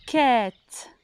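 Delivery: "Quête" long, with a released t; "Quête" held and falling; final sound stretched. Shown as a demonstration. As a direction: draw out "Quête" for careful listening. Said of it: "le son que vous entendez en dernier".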